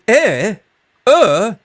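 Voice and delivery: "Eh, uh" pitched high as British vowels. Shown as a demonstration. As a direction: make "Eh, uh" British by lifting the pitch. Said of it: "it suddenly becomes British".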